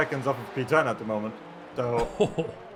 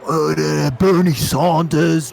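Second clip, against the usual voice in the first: louder, slow, put-on Cockney drawl; mock Cockney politician; 7 dB louder, slower than usual. Retelling an American politician's Cockney, slow and loud. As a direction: send up a politician doing Cockney. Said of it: "And he was going".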